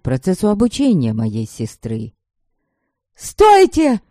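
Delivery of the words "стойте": high, loud frantic yell; frantic shout. A narrator's reading. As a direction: scream it in panic to stop. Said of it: "Заполошно закричала я".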